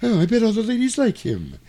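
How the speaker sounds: high, creepy tycoon impression; Mister Burns. Boss remarking on the young man to his assistant, creepy. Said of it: "a really weird Mister Burns vibe".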